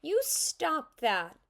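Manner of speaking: high, loud, slow scolding; indignant child telling someone off; angry. A narrator's reading. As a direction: stamp out each word crossly. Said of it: "she said angrily".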